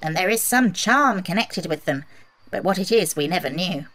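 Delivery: a lightly voiced little Munchkin man. A narrator's reading. A Munchkin telling Dorothy about the shoes, lightly voiced. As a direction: tell it like a small villager sharing lore.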